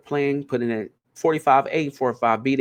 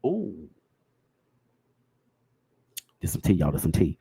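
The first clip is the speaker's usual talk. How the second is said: low gossipy tea; 10 semitones lower than usual. Real, not acted. Gossip reveal to viewers.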